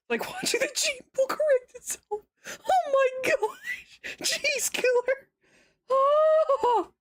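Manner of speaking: very high, squeaky with laughter; real helpless laughter, gasping between phrases; laughing fit. Real, not acted. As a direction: barely get the words out for laughing.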